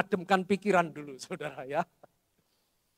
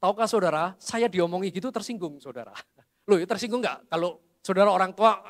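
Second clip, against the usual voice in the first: usual speaking voice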